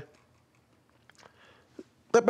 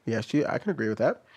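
ordinary talk

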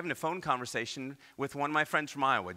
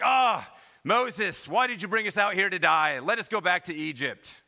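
complaining Israelites; 7 semitones higher, 8 dB louder than usual. Retold or imitated. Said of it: "They're like".

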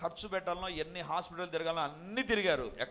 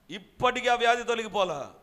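ఇప్పటికీ ఆ వ్యాధి తొలగిపోలా proclaimed higher and louder; loud emphatic repeat; 6 semitones higher, 7 dB louder than usual. Acted. Preacher booms to the congregation that the sickness remains, loud. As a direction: hammer ఆ వ్యాధి home from the pulpit. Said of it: "preacher repeats the point twice".

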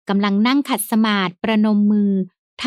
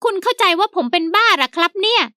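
high servant voice, a touch stern; stern, indignant high-pitched servant; servant speaking sternly; 9 semitones higher than usual. A narrator's reading. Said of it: "เจ้าแห้วพูดเสียงดุดุ".

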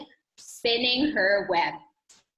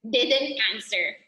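ordinary delivery, nothing marked

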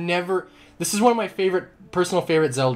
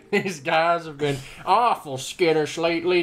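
put-on southern accent. Player voicing a farmer about skittish goats, southern accent. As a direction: try out a folksy southern accent. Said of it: "how to do a southern accent".

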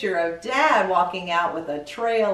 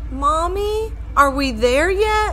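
put-on little boy asking; 7 semitones higher, 4 dB louder than usual. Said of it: "I heard Jimmy ask".

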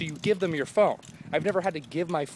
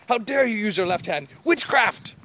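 higher and louder, building to a hammed-up witch-hunt shriek; a mock outraged crowd, screaming louder and louder; mock outraged teachers; 10 semitones higher, 7 dB louder, slower than usual. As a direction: play the scandalized old-timers crying witchcraft. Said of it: "they were like".

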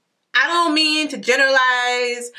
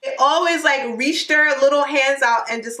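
normal talking voice

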